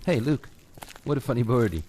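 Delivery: usual speaking voice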